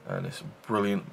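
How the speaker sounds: ordinary talk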